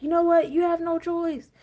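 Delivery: high, loud mock tough talk; acted-out harsh telling-off, pitched right up; blunt mock scolding. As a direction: lay down the law loudly, half laughing. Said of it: "some people you gotta just be like".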